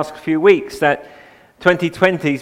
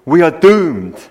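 louder, doom-laden Scottish quip; gloomy catchphrase; 5 dB louder, slower than usual. Retold or imitated. Pastor quoting Private Fraser to his congregation, doom-laden. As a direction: deliver it like a gloomy old Scot. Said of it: "he used to punctuate the dialogue with this catchphrase".

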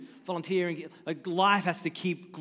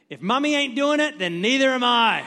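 put-on much higher, louder sassy little-girl voice; defiant child; 8 semitones higher, 9 dB louder than usual. Four-year-old refusing to walk on dad's back, sassy high voice. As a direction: deliver the kid's cheeky refusal. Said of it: "she paused and she said".

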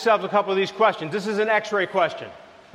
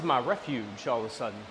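much lower and softer, almost under the breath; hushed confession; 10 semitones lower, 10 dB quieter than usual. Acted. Preacher confessing his weekend refuge, low and hushed. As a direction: drop it low like a secret. Confiding aside.

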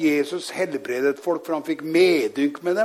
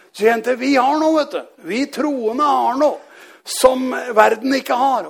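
higher, emphatic preaching with deliberate pauses; fervent preaching; 5 semitones higher, 5 dB louder than usual. Real, not acted. Preacher declares what believers have, fervent proclamation. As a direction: proclaim it with raised, forceful voice.

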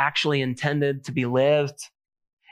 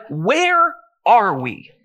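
slow, high, drawn-out bewildered question; retold bewilderment; 6 semitones higher, 6 dB louder, much slower than usual. Hiker retelling the moment they realize they're lost, bewildered. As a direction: stretch it out loudly, mock-lost.

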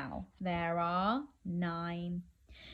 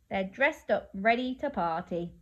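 ordinary delivery

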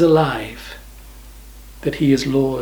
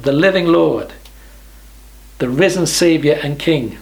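normal talking voice

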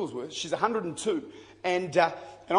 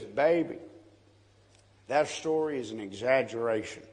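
put-on old woman's voice; 6 semitones lower than usual. Old Mary Jenkins telling the preacher the story is exaggerated, lower old lady voice. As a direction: play the old woman gently setting him straight. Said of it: "she goes".

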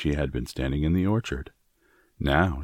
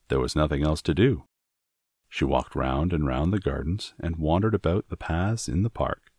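ordinary delivery, nothing marked

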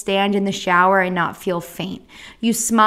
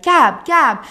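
mock movie-style cry after someone; imitated calling out; 5 semitones higher, 6 dB louder than usual. Family calling her as she is wheeled into isolation, imitated calling out. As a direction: call the name higher and louder, twice. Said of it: "They're calling my name".

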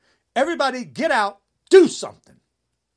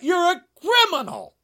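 raised, higher accusation shouted out; higher, louder angry call-out; 7 semitones higher, 3 dB louder than usual. Real, not acted. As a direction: yell the accusation at him. Ranting accusation at Trump.

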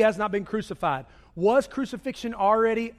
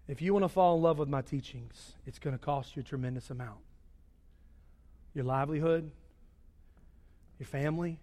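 solemn low sermon voice; 5 semitones lower, 9 dB quieter than usual. Acted. Preacher voicing Jesus to the congregation, low and grave. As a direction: speak Jesus' words gravely, quietly.

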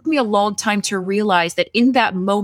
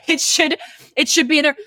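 half-laughing, raised pitch; laughing mock speech; 4 semitones higher than usual. To the other manager, mock-passionate laughing plea. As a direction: retell the big speech with laughter breaking in. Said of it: "giving an Oscar-worthy speech of".

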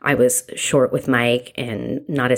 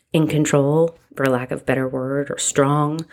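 normal talking voice

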